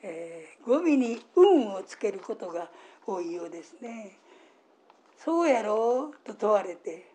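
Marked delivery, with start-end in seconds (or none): 5.2-6.2 drawn-out, louder Osaka question; demonstrated Osaka-dialect tag question. Shown as a demonstration. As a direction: stretch it out with an Osaka lilt. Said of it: "大阪弁のお話".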